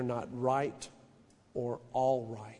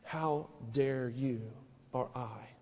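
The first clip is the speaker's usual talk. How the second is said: normal talking voice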